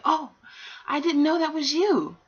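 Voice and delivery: a character yelping in surprise; startled exclamation. Acted. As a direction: blurt it out, caught off guard. Father surprised on the whale.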